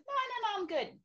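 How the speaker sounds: high, airy brush-off; breezy denial. Retold or imitated. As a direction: wave the worry away lightly. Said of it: "I was like".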